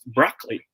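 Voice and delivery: broccoli as BRAHK-lee; two beats, BRAHK-lee, for broccoli; open O in broccoli. Shown as a demonstration. Teacher revealing the proper way, broccoli as BRAHK-lee. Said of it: "The proper way to say this word".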